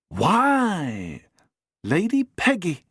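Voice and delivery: high, pleased, knowing; surprised recognition. Acted. Doctor greets Lady Peggy, knowing surprise. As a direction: greet an old foe warmly.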